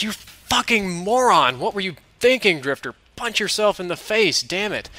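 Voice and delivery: high, slow, furious scolding of himself; self-scolding inner voice. Retold or imitated. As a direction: chew yourself out like an idiot. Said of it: "it's hitting me like a ton of bricks".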